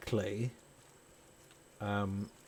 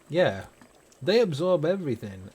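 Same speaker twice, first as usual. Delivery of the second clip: ordinary talk, nothing marked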